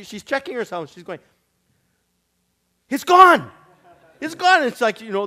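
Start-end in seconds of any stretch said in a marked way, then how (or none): 2.9-4.6 high, loud, astonished cry of the healed woman; amazed woman. Retold or imitated. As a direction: squeal it out as if you can't believe it. Woman finds tumor gone.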